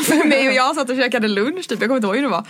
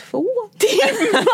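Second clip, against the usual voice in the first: much higher, slow, laughing disbelief; stunned disbelief; 10 semitones higher, slower than usual. Retold or imitated. Boyfriend overhearing she has known for hours, laughing disbelief. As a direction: gasp it out, laughing, unable to believe it. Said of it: "så han bara".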